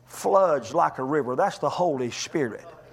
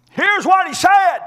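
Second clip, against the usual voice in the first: much higher and louder, drawn out; exultant preaching shout; 10 dB louder than usual. Acted. Spirit takes groanings to the Father, exultant shout. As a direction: belt it out slowly, every word pushed.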